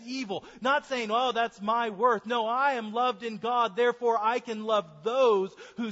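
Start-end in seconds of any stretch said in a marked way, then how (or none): none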